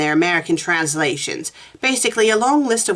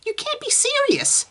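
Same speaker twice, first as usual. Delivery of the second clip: very high, incredulous squeak; incredulous character voice. Acted. Susan reacting to Meg in the story, incredulous squeal. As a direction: squeal it in high-pitched disbelief. Mock outrage read aloud.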